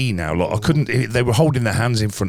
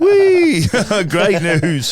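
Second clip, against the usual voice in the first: mock-joyful squeal, much higher and louder; sarcastic high "Whee!" then laughing "great news"; sarcastic cheer; 10 semitones higher, 6 dB louder, much slower than usual. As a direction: fake delight at bad news, laughing. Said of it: "I was like".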